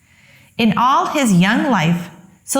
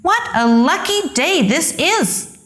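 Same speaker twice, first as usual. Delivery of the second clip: put-on higher, bright-eyed kid's voice of joy; Sylvester delighted; 8 semitones higher than usual. A narrator's reading. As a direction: marvel at your luck like a young donkey. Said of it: "thought Sylvester".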